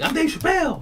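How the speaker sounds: squeaky high boast; high squeaky Chappelle boast at the courtside seats; high mock Chappelle. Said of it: "he's like".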